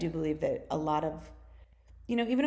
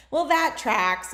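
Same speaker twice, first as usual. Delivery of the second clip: knowing exclaim; 7 semitones higher, 8 dB louder, slower than usual. Retold or imitated. Retold reaction to psychic.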